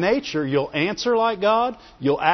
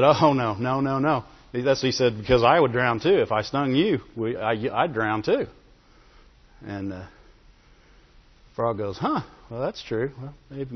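story narration; 5 semitones lower than usual. A narrator's reading. Preacher telling his church the frog and scorpion fable, hushed.

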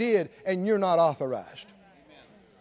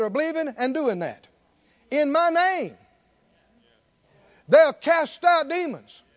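ringing pulpit recitation; preached scripture; 4 semitones higher, 3 dB louder than usual. Acted. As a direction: proclaim the commission, rising in force. Declaims scripture to congregation.

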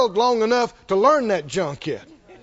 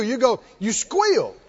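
ordinary talk

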